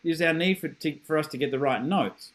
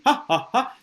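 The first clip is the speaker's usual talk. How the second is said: high, punchy ha's from the stomach; raised-pitch demonstration belly laugh; bouncing laugh pops; 6 semitones higher, 3 dB louder than usual. Shown as a demonstration. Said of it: "like, bouncing?".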